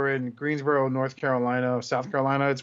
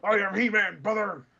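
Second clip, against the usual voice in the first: high hammed-up wrestling promo voice; wrestler promo voice; 6 semitones higher than usual. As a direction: ham it up like a cartoon wrestler bellowing brother.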